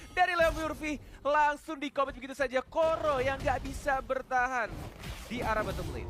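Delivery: loud play-by-play yell; fighting game announcer. Acted. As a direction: punch out the kill call. Caster calls a bottom-lane kill.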